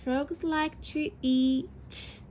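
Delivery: drawn out word by word; slow dictation. Shown as a demonstration. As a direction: read it back slowly while writing. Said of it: "an E and an A after our finger space".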